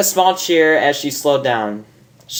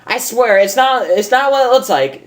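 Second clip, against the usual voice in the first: flustered denial; 10 semitones higher than usual. Acted. Rainbow panicking, caught out.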